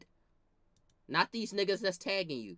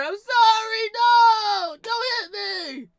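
shrill mock-crying cousin caught by grandma, much higher and louder; high crying shout; 14 dB louder, slower than usual. Cousin caught dicking the soda, mock-crying shout. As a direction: wail it like a busted kid.

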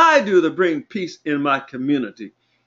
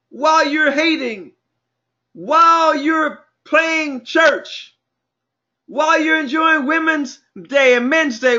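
full-force preaching; 12 semitones higher than usual. Real, not acted. Preacher warning the church the ship is sinking, full-force preaching. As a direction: sound the alarm to the congregation, pitch climbing.

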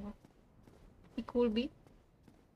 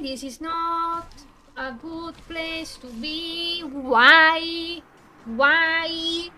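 slow lament building to a loud wail; drawn-out whining; 3 semitones higher, 7 dB louder, much slower than usual. Real, not acted. Streamer stuck in a bad place in the game, wailing complaint. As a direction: stretch the words plaintively, ending in loud cries.

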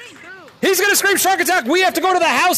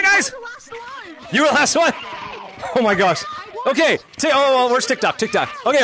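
normal talking voice

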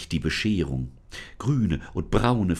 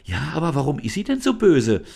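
put-on high, louder girl's voice asking; curious girl Lisa; 7 semitones higher, 6 dB louder than usual. Lisa asks the spirit why the sunflower is evil, high and curious. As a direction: ask high and puzzled. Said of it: "Wollte Lisa wissen".

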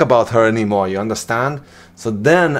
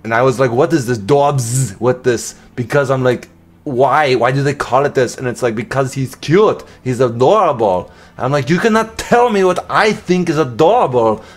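amateur Austrian-accent impression of an action star; Arnold Schwarzenegger accent. Vlogger redoing a silent unboxing for viewers, mock Austrian accent. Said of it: "in an Arnold Schwarzenegger accent".

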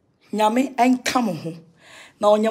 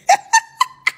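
high, loud cackling laugh; burst of laughter; 7 dB louder than usual. Real, not acted. Vlogger cracking up mid-talk, high loud laughter.

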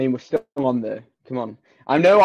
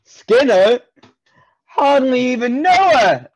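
punchline; 9 semitones higher, 6 dB louder, slower than usual. Acted. Punchline joke delivery.

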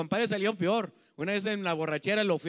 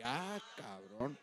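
put-on drunken mutter; 6 semitones lower, 13 dB quieter, much slower than usual. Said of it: "en mi borrachera".

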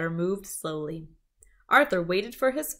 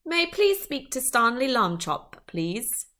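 higher-pitched polite British caller; British accent; 5 semitones higher than usual. A narrator's reading. British detective asking for Stanley by phone, English accent. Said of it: "It also had an accent".

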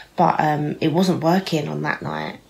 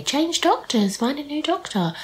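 mock advice voice; 9 semitones higher than usual. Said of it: "you could just say".

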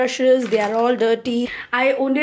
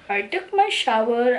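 normal talking voice

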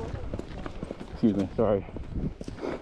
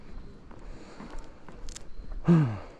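long breathy sigh; 6 dB quieter than usual. Real, not acted. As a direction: let out a long, soft exhale. Pauses to take in the view.

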